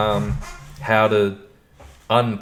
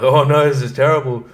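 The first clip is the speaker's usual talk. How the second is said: loud, higher, panicked sitcom voice; hammed-up embarrassed crowd; mock embarrassed; 5 semitones higher, 6 dB louder than usual. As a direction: play the horrified onlookers. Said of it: "everyone else is really embarrassed and like".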